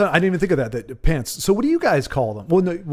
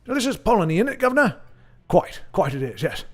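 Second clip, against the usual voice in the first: hammed-up British "guv'nor" caricature; mock British accent. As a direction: get carried away playing a cheery Brit. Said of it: "Sorry, got carried away".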